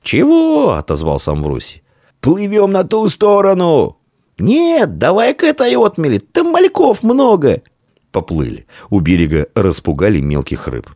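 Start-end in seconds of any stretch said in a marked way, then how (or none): 0.0-0.7 very high, louder questioning shout; calling back. Acted. Boy answering his friend from afar, calling out. 2.2-7.6 calling out. Acted. Boys planning where to swim, calling to each other. Boys shouting across the river.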